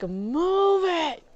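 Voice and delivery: high-pitched mock yell of command; retold yell. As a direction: re-enact yelling at a crowd. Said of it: "after I yelled".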